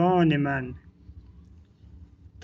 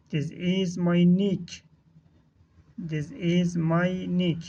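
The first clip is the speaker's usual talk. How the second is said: slow, word-by-word teaching delivery; drawn-out words with small gaps between; slow model reading; much slower than usual. Shown as a demonstration. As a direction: model the phrase slowly for learners. Teacher models phrase for learners.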